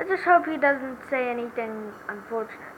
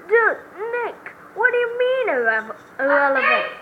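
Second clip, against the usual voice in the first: outraged protest; 6 semitones higher than usual. Acted. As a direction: protest in disbelief. Witness protests.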